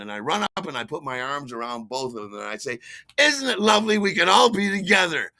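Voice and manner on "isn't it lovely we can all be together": loud, high, effusive shout; boisterous party gush; acted drunken gushing. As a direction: greet them far too enthusiastically. Drunk greets ex and boyfriend.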